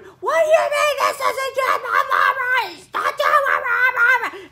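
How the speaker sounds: high, loud whiny wail at her helpers; mock screaming. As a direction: wail it like a panicked child.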